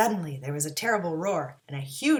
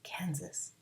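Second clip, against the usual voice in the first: thought aloud; 5 semitones lower, 10 dB quieter than usual. A narrator's reading. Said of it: "and thought".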